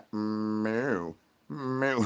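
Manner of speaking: quiet imitated meows; hushed cat meows; soft meowing. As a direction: mew softly like a cat.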